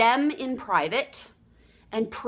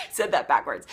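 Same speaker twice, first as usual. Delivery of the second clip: lower, said through a laugh; laughing aside; 9 semitones lower than usual. Real, not acted. Coach laughing at her backwards saying, amused. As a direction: chuckle as you admit it.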